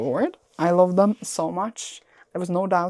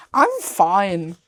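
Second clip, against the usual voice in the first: ordinary delivery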